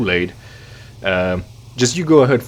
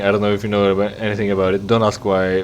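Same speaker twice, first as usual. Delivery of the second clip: ordinary talk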